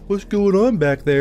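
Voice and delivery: high, puzzled call; mock curious coworkers. Coworkers asking about the noise, high and puzzled. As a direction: call out from the front. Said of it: "People were like".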